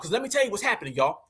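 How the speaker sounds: usual speaking voice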